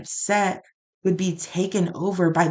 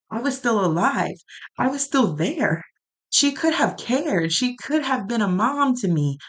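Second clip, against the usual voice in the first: rising, strained voice on the edge of tears; aching, half-breaking plea; pained pleading; 5 semitones higher than usual. Real, not acted. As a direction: plead with the hurt pushing the pitch up. Venting hurt to God.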